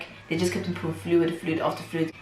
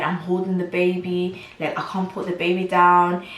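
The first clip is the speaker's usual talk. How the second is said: ordinary delivery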